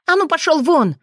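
high, loud shriek; desperate shriek. A narrator's reading. Witch Rosalie screaming the wolf out of her room, shrill. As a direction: shriek to drive him out. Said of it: "Отчаянный визг Розали".

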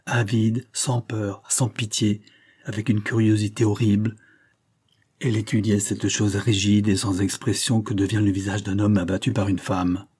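usual speaking voice

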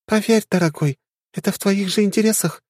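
high, teasing and sly; sly young woman. Acted. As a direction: sly smile in the voice. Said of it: "хитро улыбнулась Ари".

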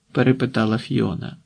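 ordinary delivery

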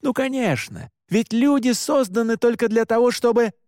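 high, indignant sarcastic burst; heated, bitter sarcasm; angry outburst. A narrator's reading. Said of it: "Мой голос дрожал от накатившего гнева".

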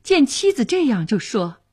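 plain reading voice

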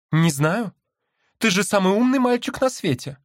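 very high mocking bird voice; sister voice. A narrator's reading. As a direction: pitch it way up, teasing.

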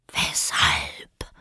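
drawn-out, whispered drowsy question; sleepy caterpillar. A narrator's reading. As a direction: breathe it out sleepily. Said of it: "mit schläfriger, langsamer Stimme". (schläfrig gedehntes Flüstern)

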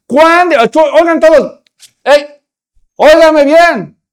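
loud, high, slow call for attention; commanding shout. Acted. Preacher voicing Jesus calling the crowd, commanding shout. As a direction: make the whole crowd listen.

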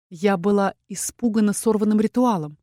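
usual speaking voice

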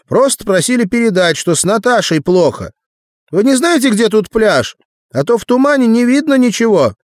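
put-on high, loud boy's voice; little boy. A narrator's reading. Boy relaying a message to Arnold, high and loud. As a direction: call it up high like a messenger kid. Boy calls up to men on fence.

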